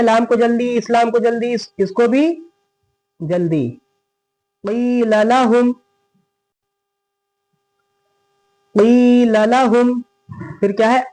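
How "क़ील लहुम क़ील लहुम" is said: "क़ील लहुम" with long "क़ी", quick "लहुम"; long vowel recitation. Shown as a demonstration. Teacher drilling stretch and quick letters, long vowel recitation. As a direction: stretch "क़ी", hurry both laams. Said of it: "क़ी को खींचेंगे".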